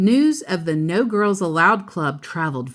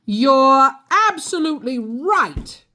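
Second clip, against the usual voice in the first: a mild mock roar, pitched up; Papa booming his agreement, hammed-up lightly; 8 semitones higher, 4 dB louder than usual. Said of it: "roared Papa".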